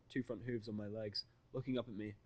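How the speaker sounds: normal talking voice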